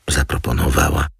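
ordinary delivery, nothing marked

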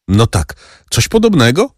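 a touch of astonishment at the paper; amazed. A narrator's reading. The major reading the certificate, mildly amazed. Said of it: "ze zdumieniem".

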